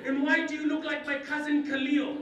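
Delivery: high, loud British schoolboy; shocked British accent. Acted. New boy in the wrong class, shocked British accent. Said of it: "And I was shocked".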